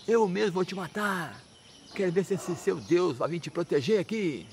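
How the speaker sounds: slow, high-pitched acted threat; stern threat. Father threatens to kill his devotee son, harsh scolding. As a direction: draw out the menace like an outraged father. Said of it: "Aquela dura assim".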